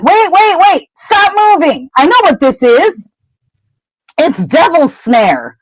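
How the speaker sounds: put-on Hermione urging the boys to freeze; urgent warning.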